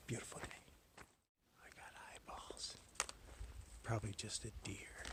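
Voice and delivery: very soft near-whisper; barely voiced murmur to himself; hushed. Real, not acted. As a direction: keep it down after spotting eyes in the dark. Spots eyes in dark woods.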